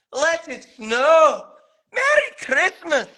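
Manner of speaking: high, loud, slow Scottish shout; Scottish accent. Shown as a demonstration. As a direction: overblown Scottish finale. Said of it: "in a Scottish accent".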